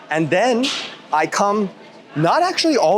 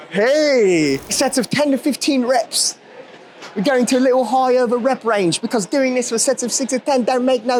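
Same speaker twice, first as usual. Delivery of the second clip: much higher, hammed-up British accent; mock British accent; 7 semitones higher than usual. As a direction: rant about rep ranges like a cheeky Brit, mate. Joking rant in put-on voice.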